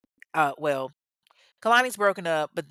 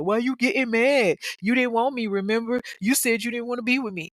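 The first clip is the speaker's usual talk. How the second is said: raised, high mock protest; higher-pitched, indignant put-on plea; defensive boyfriend; 5 semitones higher, 3 dB louder than usual. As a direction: act out a boyfriend arguing as he chases her. Acted boyfriend protesting while chasing her.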